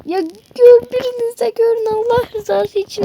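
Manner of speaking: a desperate plea for help; pleading. Acted. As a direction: plead with everyone, nearly in tears. Begging friends to see her.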